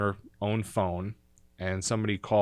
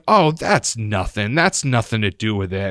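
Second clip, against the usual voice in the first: dismissive grandma; 8 dB louder than usual. Retold or imitated. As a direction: wave away the point loudly as grandma. Grandmother dismisses age remark, retold.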